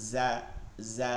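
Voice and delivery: "জা" with a soft buzzing z. Shown as a demonstration. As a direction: let "জা" buzz softly off the tongue tip. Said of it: "জিরজির আওয়াজে". ("জা" নরম জিরজির আওয়াজে)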